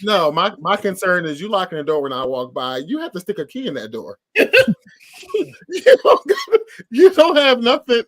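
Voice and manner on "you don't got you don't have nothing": laughing while talking. Real, not acted. Host laughing off the lady's door lock, laughing. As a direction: laugh through the words, dismissive.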